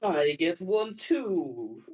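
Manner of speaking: ordinary delivery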